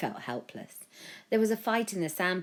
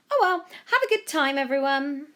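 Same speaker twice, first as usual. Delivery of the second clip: higher, louder breezy mum; cheery mum; 10 semitones higher, 7 dB louder than usual. A narrator's reading. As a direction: wave it off brightly. Mum cheerfully addresses the crowd.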